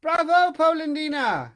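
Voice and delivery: high, loud teasing cry from the log; the hidden voice mocks him, shrill and loud; the tiny voice jeers from inside the wood; little voice, corrected. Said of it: "I got the voices mixed up".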